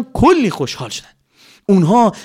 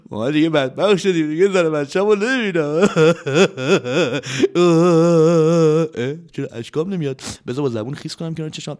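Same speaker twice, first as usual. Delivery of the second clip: fake crying; 3 semitones higher than usual.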